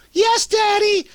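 very high put-on kid voice; obedient child. Child obediently answering a warning father, high mock kid voice. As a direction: squeak it out like a little kid.

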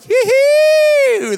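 squealed high, loud giddy cheer; hammed-up triumphant fan squeal; mock fan cheer. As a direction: squeal like a gloating Maroons fan. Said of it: "The Maroons were like".

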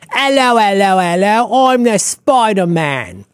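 put-on loud, high, mangled British accent; badly faked British, hammed up and drawn out; Tom Holland. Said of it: "I don't even know what accent that is".

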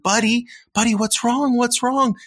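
put-on high, gentle coaxing of a crying child; sing-song parent soothing a toddler.

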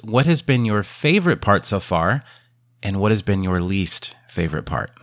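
usual speaking voice

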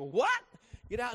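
high, loud startled "What?"; loud surprised yelp; shocked what. Retold or imitated. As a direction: jolt of disbelief. Pastor hears dead lady lives.

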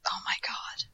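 breathy exasperated whisper. Real, not acted. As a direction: whisper it in disbelief. Exasperated at the plan.

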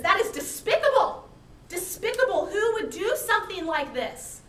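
loud, high outraged cry; furious outburst. Acted. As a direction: explode in righteous outrage. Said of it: "he's all furious".

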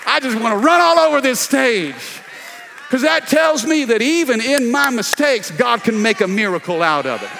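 loud, high, shouted preaching; preaching at full force. Real, not acted.

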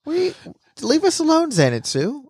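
very high mock plea; pleading Tanjiro. Voicing a chased boy begging his friend, high mock plea. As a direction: squeak it out as a hammed-up plea.

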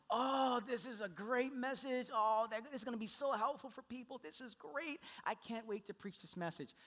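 mock-thrilled self-congratulation; excited optimism. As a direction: gush about your own brilliant idea. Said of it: "I'm like".

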